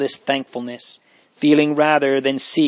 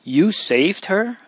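slow, higher-pitched amazed question; great astonishment; 7 semitones higher, slower than usual. A narrator's reading. Marie turning to her brothers, greatly astonished. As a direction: ask it in astonishment, drawn out and lifting. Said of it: "in still greater astonishment".

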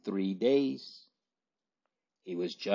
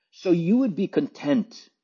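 savage cry; 8 semitones higher than usual. A narrator's reading. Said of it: "turning almost savagely upon her".

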